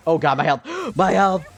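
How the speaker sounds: real panic as the health bar drops; panicked cry. Real, not acted. Gamer panicking at her health mid fight, loud alarm.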